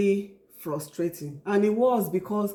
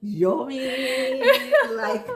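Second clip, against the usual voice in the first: laughing; 4 semitones higher, much slower than usual. Real, not acted. Laughing about weight gain.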